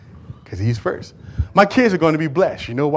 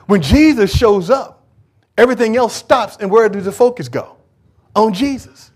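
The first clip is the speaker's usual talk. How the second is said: preaching at full force; 7 semitones higher, 6 dB louder than usual. Acted. Preacher climbs again.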